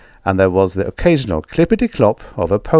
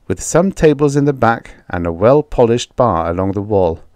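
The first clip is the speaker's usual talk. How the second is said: usual speaking voice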